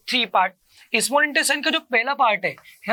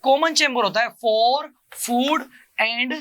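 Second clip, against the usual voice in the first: slow dictation; much slower than usual. Shown as a demonstration. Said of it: "क्या होता है बेटा जी?".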